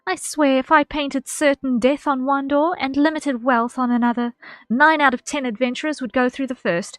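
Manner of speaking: put-on slightly southern accent. Player reading Momody's game dialogue aloud, slightly southern accent. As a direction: read it out loud and lively, drifting slightly Southern. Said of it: "she suddenly turned slightly southern".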